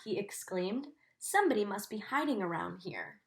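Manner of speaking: gentle storybook reading for a class; reading to children. A narrator's reading. Teacher reading Corduroy to her class, in a storybook voice. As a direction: read the story to the children, savouring each sight. Teacher reads picture book aloud.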